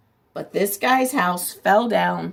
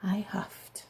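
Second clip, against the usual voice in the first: breathy whisper; hushed huff; 2 semitones lower, 13 dB quieter than usual. Acted. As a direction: hush it before the sneeze. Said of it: "felt my cold coming on".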